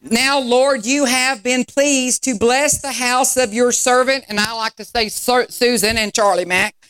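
rising sermon call-and-response; fervent sermon delivery; preaching. Acted. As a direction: preach it to the congregation. Preaching to congregation.

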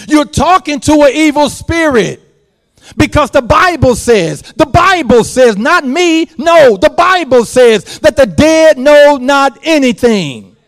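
loud, high preacher's cry; full-force preaching. Acted. Preacher warning the congregation, loud and high. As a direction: drive it home to the congregation.

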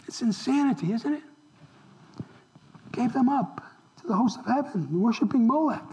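usual speaking voice

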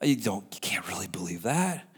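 low, soft whisper; devil's whisper. Retold or imitated. Preacher voicing the devil whispering doubts, low hiss-free whisper. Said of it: "the devil just starts whispering".

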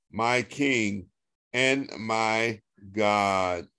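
normal talking voice

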